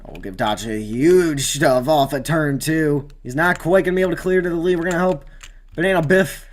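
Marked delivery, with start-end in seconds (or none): 5.7-6.5 hyped name call. Acted. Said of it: "should have put on the name reel".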